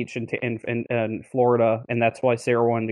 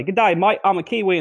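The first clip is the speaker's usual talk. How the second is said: put-on New Zealand accent; 6 semitones higher than usual. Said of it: "I'm gonna say that to everybody".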